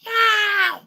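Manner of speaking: high, loud yowling meows; mock meow. Dog in her dream meowing loud and high. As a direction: cry out like a cat. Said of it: "The dog goes".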